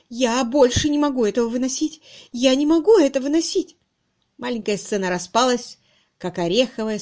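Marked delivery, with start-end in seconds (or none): 0.1-3.8 high, loud plea rising in desperation; distressed cry. A narrator's reading. Miss Twitterton breaking in on the lovers, distressed cry. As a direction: cry out as if unable to bear it. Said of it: "сдавленно крикнула мисс Твиттертон".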